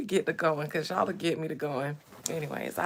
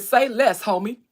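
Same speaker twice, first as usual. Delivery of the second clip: put-on loud, punched Compton accent; 4 semitones higher, 9 dB louder than usual. Streamer voicing young men protecting women, Compton accent. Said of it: "that's my Compton accent".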